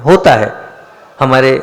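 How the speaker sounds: ordinary talk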